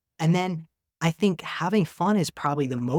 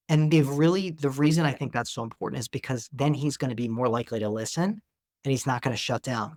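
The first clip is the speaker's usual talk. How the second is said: ordinary talk, nothing marked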